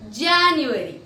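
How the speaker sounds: JANUARY stretched out and enunciated; careful pronunciation demo. Shown as a demonstration. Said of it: "I repeat".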